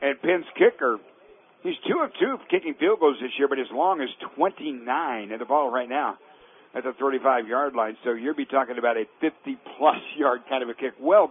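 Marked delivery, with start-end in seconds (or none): none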